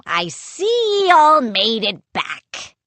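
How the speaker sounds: pirate captain voice; gruff pirate captain. A narrator's reading. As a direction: speak like a swaggering pirate.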